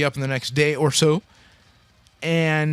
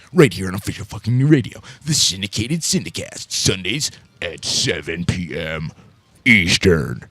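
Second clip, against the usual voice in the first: deep announcer voice; 8 semitones lower than usual. Acted. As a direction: drop it low like a trailer voice. Radio promo with silly voices.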